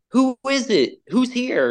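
high, startled call from another room. Retold or imitated. Someone in the back room calling out, startled and high.

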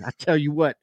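twangy coach catchphrase; Gruden twang. Acted. Co-host doing the quoted coach, full twang. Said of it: "I want the full twang".

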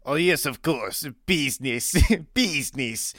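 lightly voiced Russian accent, laughing through it; a hint of a Russian host, giggling. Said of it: "with a Russian accent".

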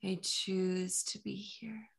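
soft, slow hypnotic induction; slow hypnotist voice. Acted. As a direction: lull them down gently and slowly. Hypnotic affirmation.

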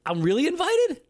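high squealing mock delight; overplayed surprise at the invite; mock excited. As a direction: gush like you've never been invited anywhere.

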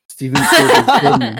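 laughing through it. Real, not acted.

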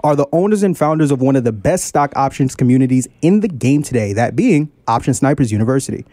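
big showman announcer intro; ringmaster. Acted. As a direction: ringmaster welcoming the crowd. Show intro in ringmaster style.

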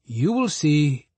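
slow, knowing spider voice; drawn out and sure of himself; slow sly Anansi. A narrator's reading. Anansi answering Monkey's warning, slow and confident. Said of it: "Anansi said".

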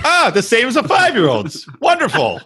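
hammed-up approval; sarcastic delight. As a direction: gush as if genuinely impressed. Sarcastic praise of childish plan.

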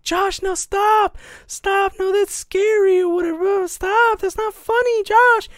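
mimicking the sister, very high and loud, begging; panicked sister. Little sister begging her brother to stop, very high frightened mock squeal. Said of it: "She's like".

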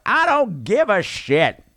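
put-on slow, drawn-out drunken defiance. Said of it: "I'm like".

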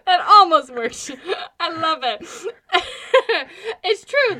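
high, loud laughing through words. Real, not acted. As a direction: laugh hard while speaking. Laughing fit.

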